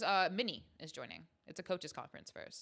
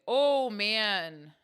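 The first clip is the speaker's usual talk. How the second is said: much louder, drawn-out mock Canadian; exaggerated Canadian accent; hammed-up Canadian accent; 3 semitones higher, 12 dB louder than usual. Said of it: "what are other things Canadians say?".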